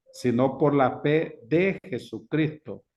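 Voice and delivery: "de" set apart and drawn out; stress on de. Shown as a demonstration. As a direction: read slowly, leaning on "de". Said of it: "subrayeme el D".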